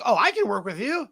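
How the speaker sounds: high-pitched, over-helpful sales offer; mock eager coach. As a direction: imitate someone jumping in to sell help. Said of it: "people are gonna be like".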